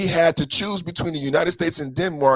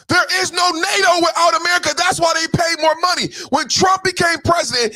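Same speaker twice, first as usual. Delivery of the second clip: much higher, loud shouting rant; shouting down the Greenland statement in outrage; furious rant; 11 semitones higher, 4 dB louder than usual. Real, not acted. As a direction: yell it out, incensed, piling up points. Furious rant against Denmark/NATO.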